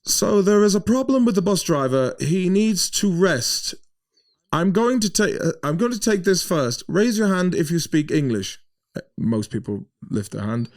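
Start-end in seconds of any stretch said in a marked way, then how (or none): 0.0-8.6 high, loud announcing voice; German passenger taking charge of the stranded bus; German woman taking charge. Retold or imitated. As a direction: address the whole bus like a self-appointed leader. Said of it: "she turns around and she goes".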